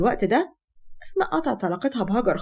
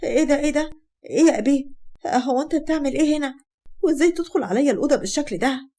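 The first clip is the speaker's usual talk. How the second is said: higher-pitched, alarmed questioning; startled awake; 4 semitones higher than usual. Acted. Hagar to the intruder in her bedroom, startled. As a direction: wake with a start and demand an explanation. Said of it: "هاجر بتقوم بخضة وبتقول".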